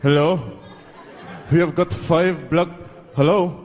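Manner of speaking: lightly voiced terrorist calling; a hint of a caller on the phone.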